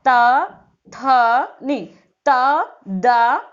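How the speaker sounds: ता, था, दा said loud, each vowel drawn out; letter-sound demo. Shown as a demonstration. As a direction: sound out ता, था, दा slowly, a pause after each. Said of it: "जैसे आप बोलते हो". (अक्षरों का ज़ोरदार, लंबा उच्चारण)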